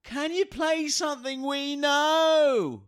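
put-on high, slow whiny girl voice; mock young woman. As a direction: whine the request like a punter. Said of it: "She goes like this".

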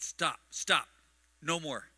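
firm, punchy scolding of a dog; short, forceful orders; stern command. Retold or imitated. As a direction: lay down the law to a disobedient dog. Sternly correcting his dog, retold.

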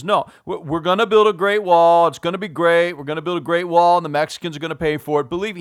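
loud, rapid-fire amateur impression; Donald Trump. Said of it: "I don't do a great Trump accent".

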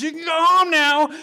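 high, loud manic release; hysterical cop. Retold or imitated. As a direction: holler it like a cop gone hysterical. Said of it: "starts laughing hyst-, hysterically".

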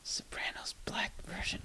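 whispered aside. Real, not acted. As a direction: whisper the joke sideways. Joke muttered aside.

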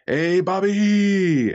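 long, drawn-out high-pitched call; mock hollering to a buddy across the room; imitated gang shout. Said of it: "would shout".

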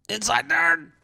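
"Inside turn!" jumped up high; high excited call. Real, not acted. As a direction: shout it up high, mocking.

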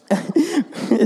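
short high laugh at himself. Real, not acted. Laughing at himself while telling the congregation. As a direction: laugh it off, amused at the story. Laughing at own anger story.

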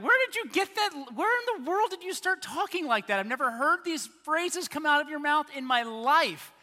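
very high, loud mock outburst; incredulous. Bewildered listener reacts to holy voice.

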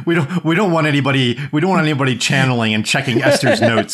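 chuckling through the words; laughing. Real, not acted. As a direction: talk while laughing. Laughing mock warning.

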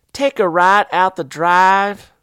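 slow, high Southern woman voice; old Southern woman. Retold or imitated. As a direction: drawl it like a country lady. Voicing the local woman.

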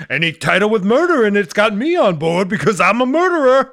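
high loud mock voice; put-on caricature voice. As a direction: keep the silly boast voice going. Bit continues.

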